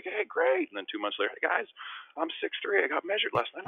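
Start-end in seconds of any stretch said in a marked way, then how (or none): 0.0-0.7 high, sing-song fake enthusiasm; lightly voiced teammates' cheer; mock cheer. Teammates mock-cheering his growth. 1.4-3.7 put-on high, eager boasting kid voice; hammed-up proud teenager; mocking teen self. Mocking his own teen announcements.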